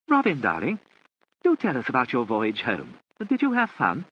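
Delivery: high, brightly chatty; bright hostess voice. A narrator's reading. Lady Charles drawing Roberta out, high and bright. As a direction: play the gushing hostess.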